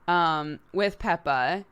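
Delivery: ordinary talk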